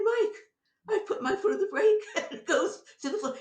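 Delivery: high, loud, fast and laughing as he cries out to Mike; panicked retold yell. Telling Mike the brakes failed, a laughing panicked yell.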